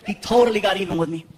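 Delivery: normal talking voice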